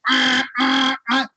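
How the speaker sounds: high, sharp beeping noises; imitated alarm beeps. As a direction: mimic a hospital alarm.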